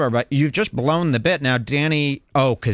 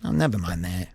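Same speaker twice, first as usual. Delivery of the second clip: shy [name]; 3 semitones lower than usual. Retold or imitated. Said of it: "this is him".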